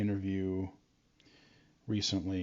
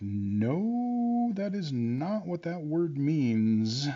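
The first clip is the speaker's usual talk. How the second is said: mock correcting classmates; 6 semitones higher, much slower than usual. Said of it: "And we're like".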